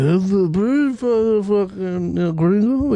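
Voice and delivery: high, slurred mock creep voice; scummy creep. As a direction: drag it out like a sleazy barfly. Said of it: "real scummy".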